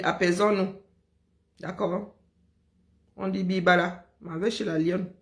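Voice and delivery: usual speaking voice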